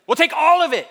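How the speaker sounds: loud, high, hammed-up threat; mock-bullying shout. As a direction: top the argument as a blustering official. State escalates threat in acted quarrel.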